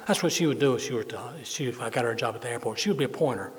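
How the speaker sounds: usual speaking voice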